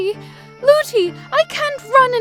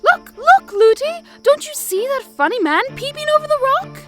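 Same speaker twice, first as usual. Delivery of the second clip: high, louder excited call; eager little girl; 6 dB louder than usual. A narrator's reading. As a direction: point something out eagerly. Princess calling out to nurse.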